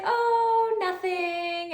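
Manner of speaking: high, innocent oh nothing; coy student voice. Retold or imitated. Student pretending nothing is up, coy and high. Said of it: "And she's like".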